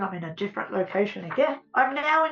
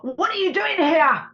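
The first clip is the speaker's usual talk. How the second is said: higher and louder, tearful scolding whisper-hiss; stern and unkind; 7 semitones higher, 7 dB louder than usual. Retold or imitated. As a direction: scold her as an intruder. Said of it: "really not being so nice to me".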